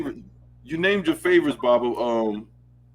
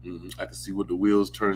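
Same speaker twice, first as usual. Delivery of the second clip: ordinary delivery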